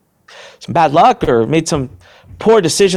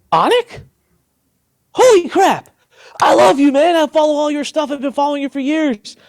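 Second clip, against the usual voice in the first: high-pitched, excited fan impersonation; starstruck fan; 14 semitones higher, 3 dB louder than usual. Retold or imitated. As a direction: re-enact a thrilled fan's outburst, pitched way up. Retells guard's starstruck recognition.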